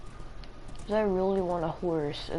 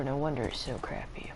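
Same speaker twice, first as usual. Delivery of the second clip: low whispered grumble; whispered aside; 5 semitones lower, 7 dB quieter than usual. Real, not acted. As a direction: whisper it as a complaint. Muttered aside about bad Wi-Fi.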